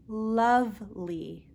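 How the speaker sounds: lovely, slow, with a clear L at the start; teacher modelling lovely slowly. Shown as a demonstration. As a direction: stretch out lovely, tongue on the L. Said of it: "I will repeat each word three times, nice and slow".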